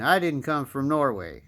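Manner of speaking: ordinary delivery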